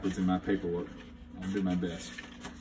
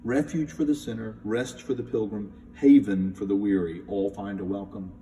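rereading for comparison; 5 dB louder than usual. Shown as a demonstration. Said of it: "what did I just read to you here?".

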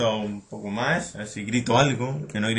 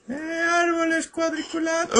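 very high squeaky exclamation; falsete juguetón. Pretend. High-voiced reaction to game scenery. (falsete muy agudo y juguetón)

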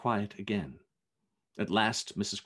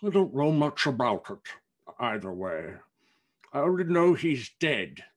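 loud, slightly raised fat man's voice; emphatic character voice, louder on key words; great fat man; 2 semitones higher, 4 dB louder than usual. A narrator's reading. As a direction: play a big, indifferent businessman. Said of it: "said a great fat man".

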